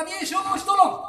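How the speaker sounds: rising cry, high and loud on tolong; a preacher's pleading cry; crying out to Jesus. Retold or imitated. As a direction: call out for help, voice climbing. Prayer cry in sermon example.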